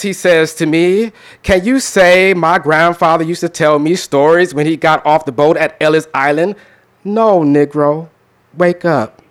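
measured, deliberate poem recitation with long pauses; spoken word poem. Acted. Performer reciting his Colorblind Poem to the audience, pointed and rhythmic. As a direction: perform it like a slam poet at the mic.

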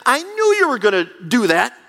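sulking Jonah. Acted. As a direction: complain like a prophet who knew it. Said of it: "and Jonah is saying".

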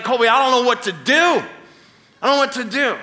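hammed-up helpless blubber; mock bawling. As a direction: imitate a panicking dad-to-be in tears. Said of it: "Starts bawling".

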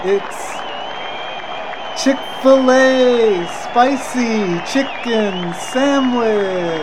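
hammed-up ring announcer, long held syllables; mock boxing intro, each phrase stretched out; Michael Buffer impression. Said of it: "I'm trying to do Michael Buffer".

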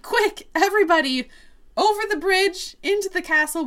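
high-pitched hurried rallying call; mock urgency. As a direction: hustle everyone along, acted. Acting Votan hurrying everyone to safety.

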